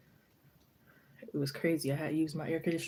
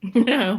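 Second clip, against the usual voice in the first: loud burst of real laughter; 7 semitones higher, 14 dB louder than usual. Real, not acted. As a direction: laugh out loud, high and bright. Laughing at own joke.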